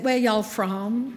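put-on slow, light Southern US accent; a hint of a Southern US twang, slowed down; Southern US drawl. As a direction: lightly imitate a North Carolina Southern accent. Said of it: "Oh, I love your accent".

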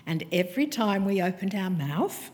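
ordinary talk, nothing marked

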